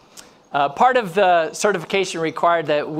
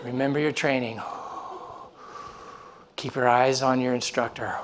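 low, softened self-talk; self-soothing; 5 semitones lower, 6 dB quieter than usual. Retold or imitated. Diver reminding himself of his training, self-soothing. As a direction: imitate talking yourself down between breaths. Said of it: "doing the same thing".

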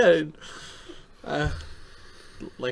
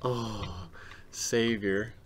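ordinary talk, nothing marked

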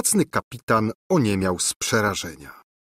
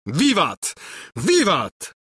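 high joyful cry; dancing with delight; cheering captain; 8 semitones higher, slower than usual. A narrator's reading. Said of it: "wołał". (radosny okrzyk kapitana)

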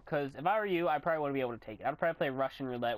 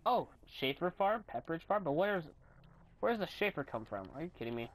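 ordinary talk, nothing marked

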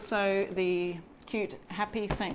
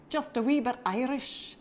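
jokey put-on Irish lilt; playful amateur Irish brogue; Irish accent; 5 semitones higher than usual. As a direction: slip into a mock Irish accent. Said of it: "that was my best Irish accent".

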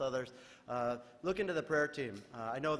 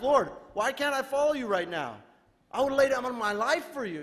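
higher, louder eager Peter; 8 semitones higher, 6 dB louder than usual. Retold or imitated. Peter insists he will follow Jesus, higher eager voice. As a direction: plead boldly, a disciple eager to follow.

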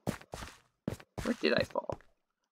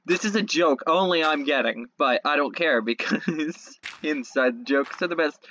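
ordinary talk, nothing marked

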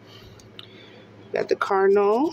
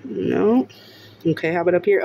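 usual speaking voice

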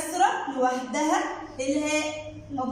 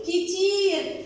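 slow, lengthened كتير to mimic the holding; drawn-out "a lot"; much slower than usual. Shown as a demonstration. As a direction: stretch the "ii" in كتير. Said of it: "لأني وقفت عليه". (تطويل كلمة كتير)

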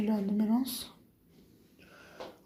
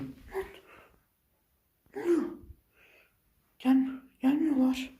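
ordinary delivery

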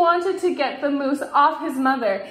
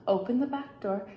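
ordinary delivery

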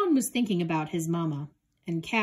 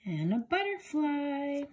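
light butterfly voice; 6 semitones higher, 3 dB quieter than usual. Acted. Title read, butterfly voice.

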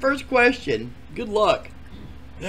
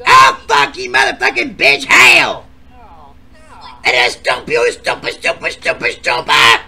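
furious threat; 7 semitones lower, 7 dB louder than usual. Acted. Granny threatening the other contestant, furious shout. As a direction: spit the threat out.

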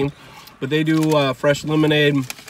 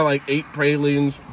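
mouth full; slower than usual. Real, not acted. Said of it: "I'm talking with my mouth full".

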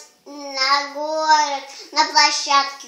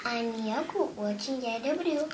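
reciting a poem; 3 semitones lower, 3 dB quieter than usual. Acted.

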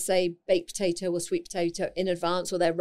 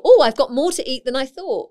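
higher, louder delighted discovery; pleasant surprise; 5 semitones higher, 6 dB louder than usual. Acted. Food lover seeing the swollen oats, acting pleasantly surprised. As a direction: act out happily finding a bigger portion.

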